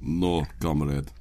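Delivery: put-on low, slow Russian-accented no; deadpan Russian accent joke; mock Russian instead of Australian. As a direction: answer in a heavy Russian accent when asked for Australian. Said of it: "can you do an Australian accent?".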